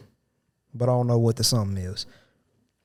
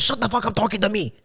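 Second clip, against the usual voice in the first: mock angry; 9 semitones higher than usual. Coworker snaps back.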